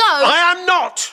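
high, drawn-out outraged retort; indignant denial. Acted. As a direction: cut in with an offended protest. Dad cuts in on accusation.

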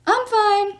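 high loud call over the shoulder; breezy yell mid-sprint; yelled while running. A narrator's reading. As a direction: shout it as you dash past. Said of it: "Percy yelled as he ran by".

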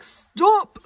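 high, loud pretend kid call of Stop; mock student stop. Teacher voicing a student pausing her video, high and loud. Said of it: "Be like".

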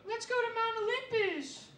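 high mock of his girlfriend's suggestion. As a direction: pitch it up like a nagging girlfriend. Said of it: "she go".

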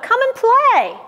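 high, sing-out invitation; calling out. A narrator's reading. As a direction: call the animals over, pitched right up. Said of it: "Farmer saw the animals and called out".

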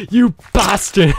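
slow, high, loud pretend anger; hammed-up indignant shout at a mob; mock outrage. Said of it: "I'm just kidding".

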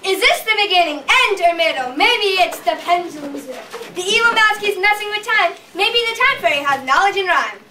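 sing-song rhyming storyteller voice; rhyming storybook narration. Acted. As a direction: chant the rhyme like a storybook.

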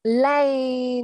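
LANE drawn out slowly; slow reading. Shown as a demonstration. Teacher modelling the slow reading, LANE drawn out. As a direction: stretch LANE long, with a lingering end. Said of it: "khi chúng ta đọc chậm".

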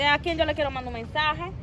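ordinary talk, nothing marked

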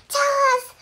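high, loud eager cry; excited shout. Acted. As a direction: blurt it out eagerly. Excited kids ask for news.

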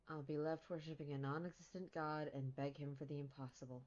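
soft, subdued heartbreak; low-key, hopeless murmur; quiet resignation. Acted. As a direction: let the hope drain out quietly. Acted heartbroken resignation in scene.

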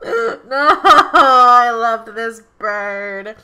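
loud, slow hammed-up wail of grief; mock wail. Vlogger wailing over her dead Sims bird, hammed-up loud lament.

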